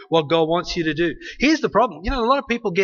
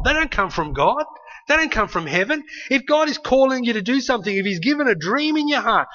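usual speaking voice

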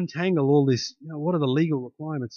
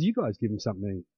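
calm instruction; 8 semitones lower, 7 dB quieter, faster than usual. Retold or imitated. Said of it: "He just said to his disciples".

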